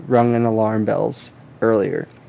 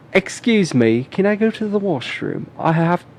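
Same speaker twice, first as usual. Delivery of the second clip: mock British accent; 6 semitones higher, slower than usual. Kid mimicking British accent.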